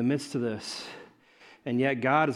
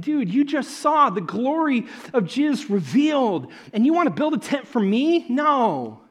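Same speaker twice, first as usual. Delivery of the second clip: exasperated Elijah; 10 semitones higher, 5 dB louder, slower than usual. Retold or imitated. Elijah voiced rebuking Peter.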